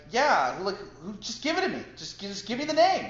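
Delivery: high, pushy pleading; insistent retold demand. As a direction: reenact badgering the host for it.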